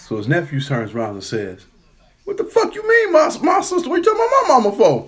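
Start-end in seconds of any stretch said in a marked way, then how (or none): 2.2-5.1 high, loud, sputtering indignation; retold furious outburst. Man acting out nephew defending his mama, furious high shout. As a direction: explode in a high mock yell.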